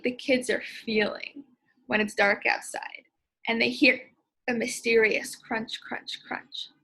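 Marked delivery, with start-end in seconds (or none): 5.4-6.7 much softer whispered crunches; spooky whisper. Shown as a demonstration. Kids hearing a mysterious crunch at night, whispered. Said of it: "make my voice sound like what's happening".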